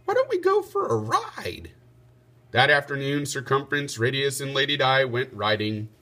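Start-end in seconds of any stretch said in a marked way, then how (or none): 0.1-1.7 put-on high little boy's voice; a small son chiming in eagerly; piping child voice. A narrator's reading. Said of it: "Radius piped up".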